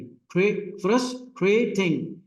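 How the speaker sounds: Frustrating slowed, tray held long; stressed syllable demo. Shown as a demonstration. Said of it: "higher भी है".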